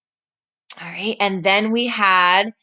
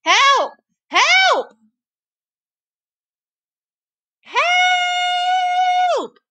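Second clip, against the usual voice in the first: crying for help; much slower than usual. Acted. Granny trapped in the cooking pot, crying ever higher for help. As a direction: scream for help, ever more desperate. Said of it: "Granny cried".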